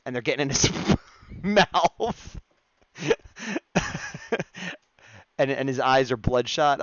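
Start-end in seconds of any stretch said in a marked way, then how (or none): 3.0-4.7 a real burst of high laughter. Real, not acted. Streamer cracking up at his own drawing, laughing. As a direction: laugh high and free at the joke.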